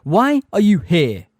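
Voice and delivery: loud, slow, high challenge; bellowed demand. A narrator's reading. Grey centaur demanding Umbridge's business, slow bellow. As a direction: hurl each word at them. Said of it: "bellowed the hard-faced grey centaur".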